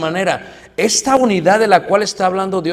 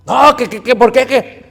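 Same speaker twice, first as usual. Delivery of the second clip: higher, louder indignant snap; angry retort; 7 semitones higher, 5 dB louder than usual. Acted. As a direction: bristle back at the correction. Angry brother reacting.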